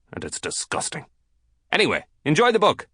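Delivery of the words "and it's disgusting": muttered disgust. Acted. Scolding nose-picker.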